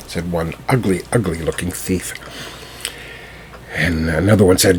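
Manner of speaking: usual speaking voice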